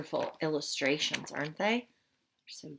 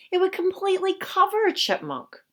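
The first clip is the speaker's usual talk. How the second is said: amused; 12 semitones higher, 7 dB louder than usual. Acted. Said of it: "Mister George laughed".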